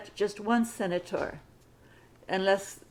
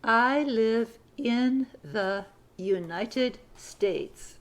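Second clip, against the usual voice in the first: very slow, word by word; very slow dictation; much slower than usual. Shown as a demonstration. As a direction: stretch the sentence out for dictation. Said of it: "Sentence number fifty-four".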